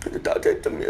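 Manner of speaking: high, squeaky mock-crying voice; tearful imitation. New father answering through his tears, imitated crying. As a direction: blubber it out, half laughing. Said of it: "울면서 얘기했던 기억이 있습니다".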